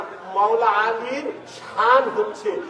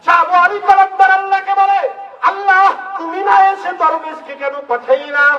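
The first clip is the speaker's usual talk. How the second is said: fiery preaching; 10 semitones higher than usual. Acted. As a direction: call it out over the crowd. Preaching story at full force.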